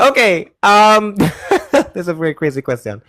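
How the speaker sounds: ordinary talk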